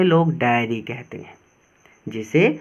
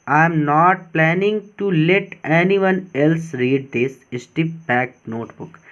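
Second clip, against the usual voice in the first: teacher reading the diary passage slowly; slow reading aloud; much slower than usual. Shown as a demonstration. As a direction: read the passage slowly, like a dictation. Slow reading of English text.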